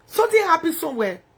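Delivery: usual speaking voice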